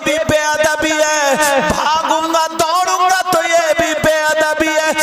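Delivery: loud, chanted sermon storytelling; preaching at full force. Acted. Preacher to the congregation, telling the Prophet's story, fervent chanting preaching. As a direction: preach it with swelling fervour, half sung. Impassioned sermon over music.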